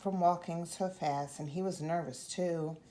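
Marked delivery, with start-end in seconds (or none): none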